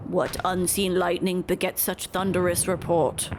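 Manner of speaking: a grave bird chief giving formal thanks; ceremonious creature elder, unhurried; Vanu chieftain voice. Acted.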